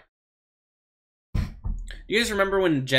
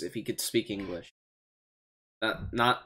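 ordinary talk, nothing marked

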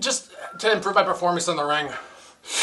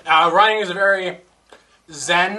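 usual speaking voice

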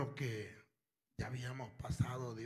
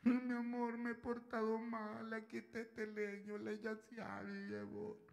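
higher, pleading mock whimper; a hint of whiny pleading; whiny husband; 9 semitones higher, 4 dB quieter than usual. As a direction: play the pitiful bewitched husband. Weepy bewitched man voiced.

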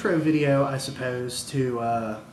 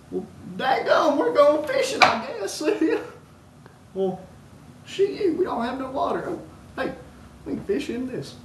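high-pitched, hammed-up country bumpkin; put-on hillbilly; 12 semitones higher than usual. Yokel announcing a fishing trip without any water, high hillbilly voice. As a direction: squeal it out like an excited yokel. Acted backwoods fishing bit.

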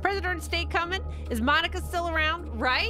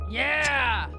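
triumphant cheer; 6 semitones higher, 4 dB louder than usual. Real, not acted. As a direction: cheer the escape. Celebrating escape.

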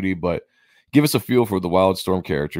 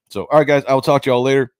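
loud sign-off; 6 semitones higher, 6 dB louder, faster than usual. Real, not acted. Cheery sign-off to viewers.

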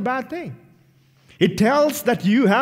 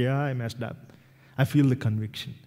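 softer, lower, ashamed confession; remorseful admission; 6 semitones lower, 5 dB quieter than usual. Retold or imitated. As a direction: own up quietly. Convicted believer admits sin.

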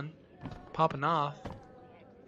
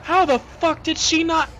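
loud, high cries of terror; panicked screaming; 9 semitones higher, 11 dB louder than usual. Real, not acted. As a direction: yell in horror at being spotted. Spotted by enemy.

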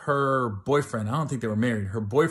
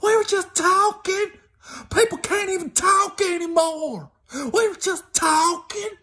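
hammed-up indignant girlfriend wail; whiny girlfriend; 3 dB louder than usual. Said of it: "I just remember her in her robe".

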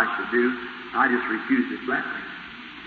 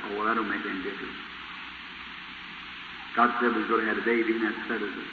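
softer, fast, easy dismissal; calm brush-off; 3 semitones lower, 10 dB quieter, faster than usual. Retold or imitated. Preacher soothing his nervous wife, soft and quick. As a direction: wave the worry away quietly.

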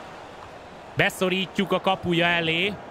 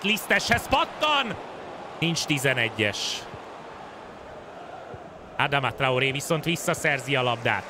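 ordinary talk, nothing marked

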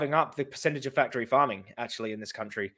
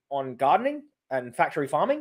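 normal talking voice

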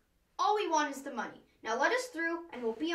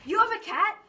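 eager surprise; 8 semitones higher, 6 dB louder than usual. Acted. Jumps in eagerly.

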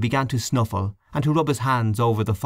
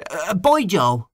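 sudden call; 8 semitones higher, slower than usual. Acted. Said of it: "called out suddenly".

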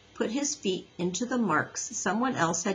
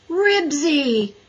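loud, high exasperated groan; loud dismayed cry of the name; 7 semitones higher, 6 dB louder than usual. A narrator's reading. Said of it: "groaned Henry".

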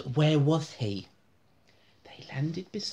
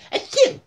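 loud, high, hammed-up achoo; theatrical sneeze for the kids; big comic sneeze; 11 dB louder than usual. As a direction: blast out a cartoon sneeze. Character sneezes again.

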